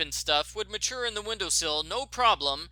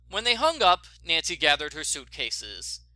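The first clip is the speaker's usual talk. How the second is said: usual speaking voice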